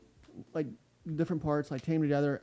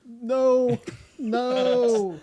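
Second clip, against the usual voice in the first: laughing no; 8 semitones higher, 7 dB louder than usual. Real, not acted. Refusing joke offer.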